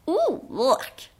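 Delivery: disgusted "ooh, yuck" noise; bruit de dégoût. A narrator's reading. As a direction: retch in mock disgust at the taste. Tasting too salty porridge. (beurk de dégoût)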